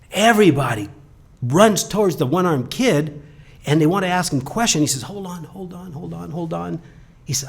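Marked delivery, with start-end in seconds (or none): 5.0-6.8 calming hold on. Retold or imitated. Kid calms crowding reporters.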